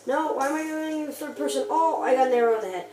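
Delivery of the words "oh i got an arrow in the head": panicked, loud oh no; frantic gamer under attack; being chased. Real, not acted. Mob attack in game.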